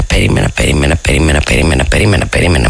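low, drawn-out repetition; slow repeated waiting. Retold or imitated. Friend retelling how long she waited, drawn out. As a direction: stretch out the endless waiting. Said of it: "«Ράνια, περίμενα".

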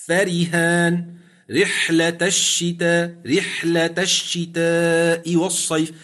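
فرهان recited slowly, ra thinned; Quranic recitation. Shown as a demonstration. Sheikh reciting an example to the student, slowly. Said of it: "كما في قوله مثلًا فرهان".

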